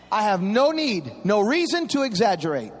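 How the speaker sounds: usual speaking voice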